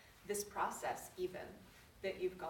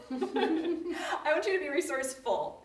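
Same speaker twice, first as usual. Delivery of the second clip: laughing; 8 semitones higher, 8 dB louder than usual. Real, not acted. Laughs at own line.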